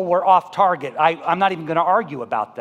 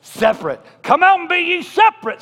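put-on old-time preacher quoting scripture; 5 semitones higher, 6 dB louder than usual. Mocking the preachers of his upbringing, booming. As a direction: thunder the verse like a fire-and-brimstone preacher. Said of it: "I was told verses like this".

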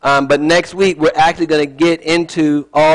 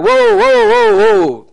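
high, loud drawn-out whoas; hammed-up, high-pitched plea to halt; alarmed whoa; 4 dB louder, slower than usual. Moses halting an angry God, alarmed high whoas.

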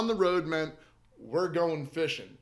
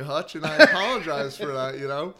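laughing apology; 7 dB louder than usual. Real, not acted.

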